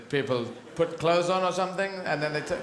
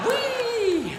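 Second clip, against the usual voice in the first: gleeful whee; 3 dB louder than usual. Retold or imitated. Sliding down fire pole.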